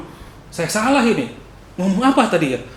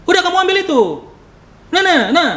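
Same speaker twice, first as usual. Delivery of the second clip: much higher and louder, blunt Batak-style order; 12 semitones higher, 5 dB louder than usual. Shown as a demonstration. Preacher showing his loud high-pitched way of speaking to friends. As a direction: bark it out high, sounding angry without meaning it. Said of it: "Nadanya tinggi".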